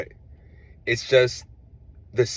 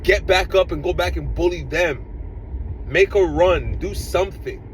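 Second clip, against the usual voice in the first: exasperated yelling; 6 semitones higher than usual. Real, not acted. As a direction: demand he fight back, fed up. Fan yelling at his striker.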